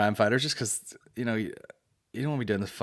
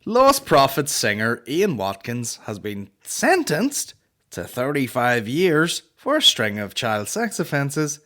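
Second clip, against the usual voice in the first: reading out the news; 4 dB louder, slower than usual. Real, not acted. Reading the headline to viewers, slow and stunned. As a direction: read the sentence out slowly, stunned. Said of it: "listen to this sentence".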